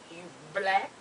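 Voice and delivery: low, hushed imitation of the man; strange man's questioning. Strange man questions her in the waiting room, low imitation. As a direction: imitate him in a low, softened voice.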